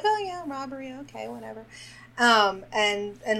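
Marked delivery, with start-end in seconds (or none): 0.0-1.6 soft, offhand imitation of total indifference; blasé. Podcaster imitates the saloon girl hearing of a robbery, blasé. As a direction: shrug it off, trailing away quietly. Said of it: "like, so blasé".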